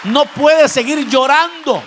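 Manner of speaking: loud, slow and emphatic; firm rebuke. Acted. God telling Samuel to stop weeping, loud and slow. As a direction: preach it out as God's stern command.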